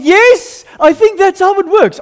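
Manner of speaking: loud, high-pitched elated shout; excited triumph. Retold or imitated. New convert realises obeying the Spirit works, excited. Retold triumph after mother's reaction.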